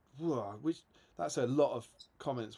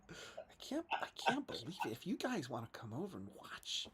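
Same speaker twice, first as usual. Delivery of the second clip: quiet mock American accent; Devon Moreno; 9 dB quieter than usual. Shown as a demonstration. Host imitating an acquaintance inviting people to watch, softly mimicked American accent. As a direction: plug a channel softly in a put-on American voice. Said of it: "My American accent is Devon Moreno".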